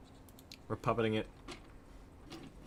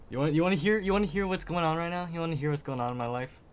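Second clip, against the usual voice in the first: worked-up rant opener; 8 semitones higher, 5 dB louder than usual. Real, not acted. Ramping up to vent.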